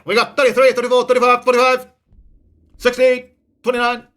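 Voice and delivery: loud, high, rapid auction chant; auctioneer. Pretend. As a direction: rattle off bids like an auctioneer. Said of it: "I feel like an auctioneer".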